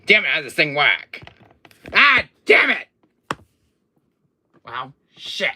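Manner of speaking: loud, high outburst of frustration; exasperated cursing. Acted. Villain fighting with his camera, exasperated.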